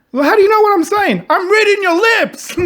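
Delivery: high, loud, laughing retold dialogue; mock baffled exchange. Retelling a movie scene, laughing through both lines. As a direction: play both characters breaking into laughter.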